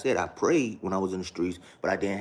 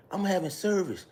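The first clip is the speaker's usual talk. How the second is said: much higher mock pastor voice; imitating father-in-law; 11 semitones higher than usual. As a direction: pitch way up to mimic him. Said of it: "This is how her father talked".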